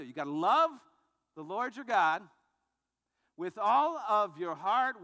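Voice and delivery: loud, slow and emphatic, building on love; emphatic proclamation. Acted. Preacher proclaiming the commandment to the congregation, loud and slow. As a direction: proclaim it like a preacher at full force.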